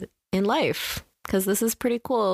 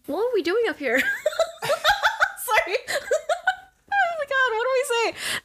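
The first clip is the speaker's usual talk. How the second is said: laughing. Real, not acted. Flustered laughing at award question.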